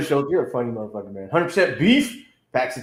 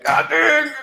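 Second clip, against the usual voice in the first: exasperated squeak; 13 semitones higher, 4 dB louder than usual. Retold or imitated. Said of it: "I was like".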